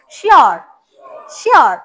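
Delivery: শিওর with the ending stretched long; drawn-out sure. Shown as a demonstration. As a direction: lean on the vowel of শিওর and hold it. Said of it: "টেনে বলো". (শিওর টেনে লম্বা করে)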